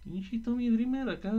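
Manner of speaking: normal talking voice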